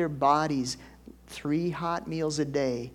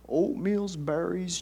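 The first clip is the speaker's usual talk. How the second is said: ordinary delivery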